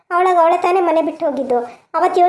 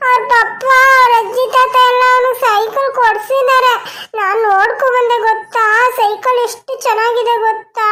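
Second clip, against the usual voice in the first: put-on higher, whimpering child's tantrum; whiny crying boy; 7 semitones higher than usual. Grandson crying to grandparents for a bicycle, whining. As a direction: sob and whine to get the bike.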